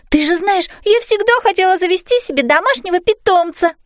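put-on high, eager girl's voice; pleading little daughter. Girl begging mum to keep the dragon, pleading. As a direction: plead high and hopefully for a pet.

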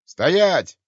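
высокий громкий крик-приказ; останови чужаков резким криком; окрик часового. Acted. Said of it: "крикнул кто-то из темноты". (грозный окрик из темноты)

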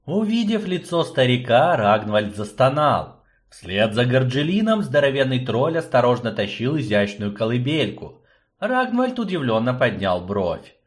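plain reading voice

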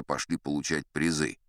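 usual speaking voice

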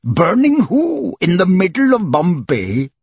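high, incredulous question; extremely surprised. A narrator's reading. Judge cannot credit a burning in Bombay, astonished. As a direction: raise the pitch and query it in disbelief. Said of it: "the judge extremely surprised".

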